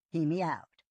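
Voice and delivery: ordinary delivery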